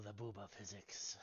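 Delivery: breathy whisper. Real, not acted. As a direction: hush it under your breath. Whispered aside.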